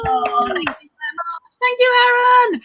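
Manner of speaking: high, drawn-out gushing thanks; excited thanks. Real, not acted. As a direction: gush it high as you come on stage. Comedian greets host on entrance.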